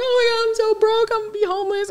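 high, mock tearful wail; retelling to her mom, a high imitated wail of despair; imitated crying wail. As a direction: replay the meltdown in the car, half-sobbed. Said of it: "busted out crying".

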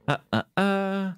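high, slow, repeated filler while mimicking someone thinking; thinking hesitation. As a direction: imitate a streamer's drawn-out thinking noise. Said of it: "All I can do is the, uh, uh, uh, when I think".

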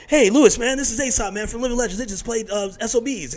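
high loud mock-eager intro; eager buddy. Rapper imitating his friend introducing him to Louis C K. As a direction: hype up the introduction. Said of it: "He goes".

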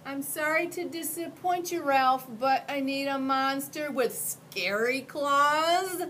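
put-on high, hesitant little boy; a polite kid asking carefully; young boy voice. Boy speaks to Ralph.

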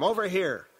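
loud, high summons; calling shout. A narrator's reading. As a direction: shout to draw someone over. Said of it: "Paul shouted".

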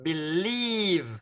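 Believe with a long drawn-out ee; stretched ee. Shown as a demonstration. Teacher models Believe for students, ee stretched long. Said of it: "kéo dài âm y ra".

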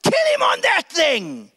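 king shouting in rage; furious order. Acted. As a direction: bark the execution order. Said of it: "He's like".

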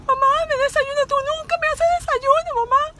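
high, loud mock-whining daughter; whiny daughter. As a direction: whine like a dramatic teen, high and loud. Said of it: "se levantaba y hablaba".

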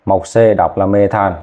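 usual speaking voice

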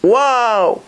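high startled double wow; retold shout. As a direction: cry out at the sight of the number. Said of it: "he gave a shout, he screamed".

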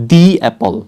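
The apple, "The" a long high "dee". Shown as a demonstration. Teacher modelling the article before a vowel, "dee apple". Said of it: "অ্যাপল যদি থাকে তাহলে কি হবে?".

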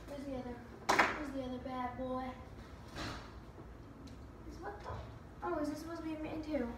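ordinary delivery

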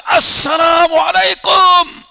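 high, slow, loud greeting that carries far; loud call. Retold or imitated. Prophet calling the greeting a third time, loudly. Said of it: "اور بلند آواز".

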